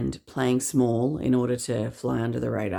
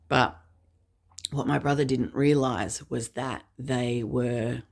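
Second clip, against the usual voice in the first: ordinary talk, nothing marked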